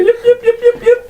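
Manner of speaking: very high yipping kobold cries; high panicked yips. Acted. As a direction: yelp like a terrified little kobold. DM voices cowering kobold.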